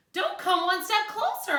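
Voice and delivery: high, loud warning yell. Acted. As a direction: yell to keep him away. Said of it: "I yelled".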